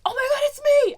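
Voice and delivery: very high, loud, thrilled squeal; excited squeal. Retold or imitated. Fan spotting her alien double, squealing excitedly. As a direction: shriek it like spotting yourself on screen. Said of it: "And I was like".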